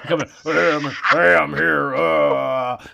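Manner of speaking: loud goofy put-on voice; boyfriend voice. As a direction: barge in as the boyfriend. Voicing the boyfriend coming in.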